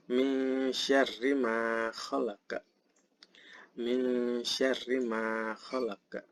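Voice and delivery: slow verse, "Min" held as a veiled nasal hum; slow chant with bounced q ending "khalaqa"; ikhfa recitation. Shown as a demonstration. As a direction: blur the n of "Min" into "syarri". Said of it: "di sini ada ikhfa atau samar".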